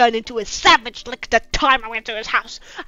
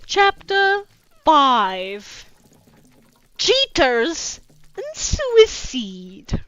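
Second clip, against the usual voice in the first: slow, drawn-out dramatic title; mock solemn chapter heading; title announcement; 3 semitones higher, 3 dB louder, much slower than usual. Real, not acted. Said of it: "announcing chapter title".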